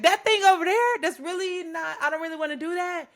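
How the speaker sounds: high, loud whine of refusal; whiny complaint to God. Retold or imitated. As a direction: protest like a stubborn child.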